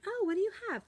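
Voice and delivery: very high, bright mock-curious teacher reply; sing-song teacher voice. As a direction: answer a small child in a high sing-song. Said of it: "And I was like".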